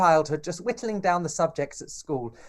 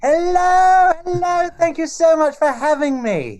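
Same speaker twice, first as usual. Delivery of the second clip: big, high, bright hello; excited greeting; 11 semitones higher, 7 dB louder than usual. Real, not acted. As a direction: greet the host with gusto. Drag queen guest greets host.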